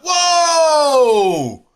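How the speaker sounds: long, high, loud frightened cry of "Whoa!"; scared whoa. Retold or imitated. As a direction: yelp out in panic, stretched long. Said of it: "And I'm like".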